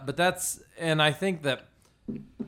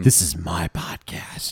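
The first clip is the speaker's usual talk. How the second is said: slow playful whisper; 8 semitones lower, much slower than usual. Pretend. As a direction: whisper it slowly, possessive. Playful claim of ownership.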